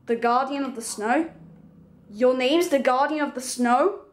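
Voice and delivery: normal talking voice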